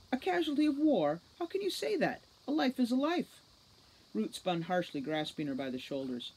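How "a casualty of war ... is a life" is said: much higher, louder disbelief; incredulous. A narrator's reading. Holly outraged at the commander, incredulous and higher. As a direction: throw his words back in disbelief. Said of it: "Holly was incredulous".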